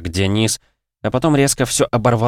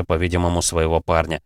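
ordinary delivery

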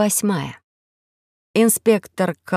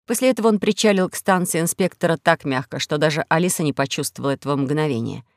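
plain reading voice

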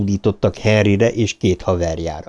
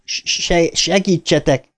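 high, urgent stammer; stuttered plea; 7 semitones higher, slower than usual. A narrator's reading. Bill asks his friends to help lift, stuttered plea. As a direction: catch on the first syllable, then call for help.

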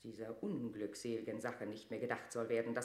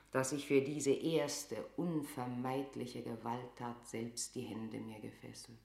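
normal talking voice

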